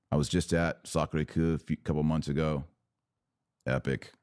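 normal talking voice

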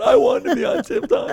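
very high mock kid voice; mocking childish whine. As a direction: parrot the child back in a squeaky tone. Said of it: "Pastor Wolven's got".

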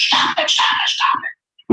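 faint echo effect; whispered echo. Retold or imitated. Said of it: "And then he hears".

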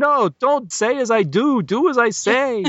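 high, slow, emphatic scolding; mock-European coach, drawing out each word; exasperated accented coach. Coach scolding Terry for mimicking him, high and exasperated. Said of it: "including his accent".

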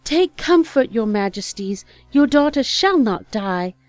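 high, loud ringing proclamation; loud fairy voice. Acted. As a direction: announce it boldly to the court. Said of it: "in a loud voice".

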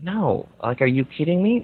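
ordinary talk

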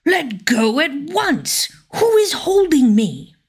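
high, furious royal cry; angry shout. A narrator's reading. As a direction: yell it, trapped and enraged. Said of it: "he called out angrily".

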